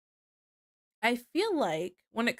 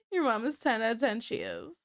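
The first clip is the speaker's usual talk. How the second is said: ordinary talk, nothing marked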